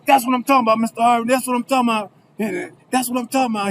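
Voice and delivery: high-pitched excited cheering; jubilant. Retold or imitated. Roommate celebrating the acquittal, jubilant. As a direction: whoop it up for a friend's win.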